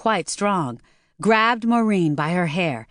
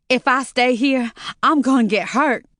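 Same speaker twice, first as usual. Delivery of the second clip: panicked; 7 semitones higher, 4 dB louder than usual. A narrator's reading. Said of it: "almost hyperventilating".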